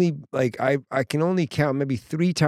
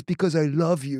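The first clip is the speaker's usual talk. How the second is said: imitated dad. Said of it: "He goes".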